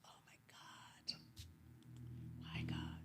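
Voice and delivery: real near-tears, voice dropping to a whisper; close to tears. Real, not acted. Grandmother recalling the hospital badge, on the verge of crying. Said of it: "I'm about to cry now".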